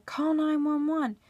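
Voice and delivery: lightly acted urgent demand; urgent plea. Wife to the guy at the counter, urgent plea.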